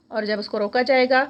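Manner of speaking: normal talking voice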